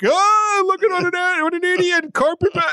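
high put-on jeering voice; exaggerated high-pitched mock outrage; mocking online pile-on. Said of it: "you just like".

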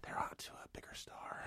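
hushed whisper of bad news. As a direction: breathe it out as a whisper. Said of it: "they were like".

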